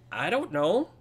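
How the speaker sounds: put-on high child voice, rising questions; puzzled little boy. Boy asking the visitor about cow theft, puzzled child voice. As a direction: ask it as a curious, high-voiced small boy. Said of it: "the boy kinda tilts his head".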